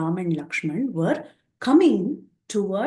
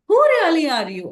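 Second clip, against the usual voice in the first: high, loud opening on WHO, then falling; insistent questioning; 9 semitones higher, 6 dB louder than usual. Acted. Hanuman probes who the strangers really are, insistently. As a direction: press them for the truth, acted.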